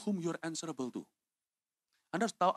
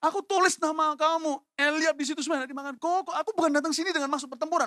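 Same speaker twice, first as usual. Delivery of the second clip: high, loud protest; indignant self-defence; 13 semitones higher, 6 dB louder than usual. Acted. Younger brother denying he came for the battle, high loud protest. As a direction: argue back at full pitch. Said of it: "mestinya kan gini".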